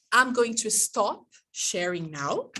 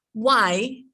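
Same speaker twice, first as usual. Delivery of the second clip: ordinary delivery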